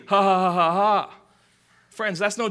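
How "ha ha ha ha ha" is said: loud, hammed-up mock laughter; fake laugh. Pranksters laughing off the harm, loud fake laugh. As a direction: spell out a fake ha-ha.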